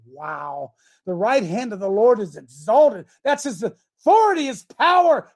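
fiery preacher reading scripture; preaching. Acted. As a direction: preach it with full force. Preacher reading the psalm aloud.

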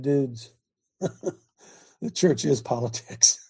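slow, amused laughing reply through a chuckle; laughing retort. Retold or imitated. Preacher laughing as he retells telling church folks. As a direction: laugh it out, drawing the words slowly.

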